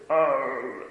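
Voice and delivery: low rumbling God-talk imitation; deep voice. Imitating preachers with deep voices, low drawn-out rumble. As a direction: drop right down into a mock deep voice. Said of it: "I wish I had a deep voice".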